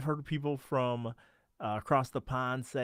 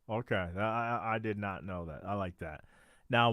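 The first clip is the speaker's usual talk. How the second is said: usual speaking voice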